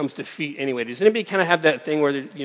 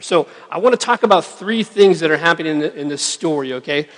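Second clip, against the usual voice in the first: ordinary talk, nothing marked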